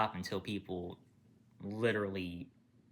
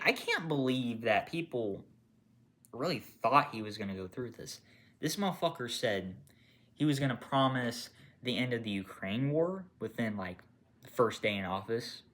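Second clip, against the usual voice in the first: ordinary delivery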